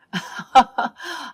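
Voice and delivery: light laugh mid-sentence; amused little laugh; short laugh. Acted. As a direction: laugh it off briefly. Wife jokes about spilled wine.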